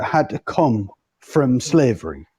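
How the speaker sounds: ordinary talk, nothing marked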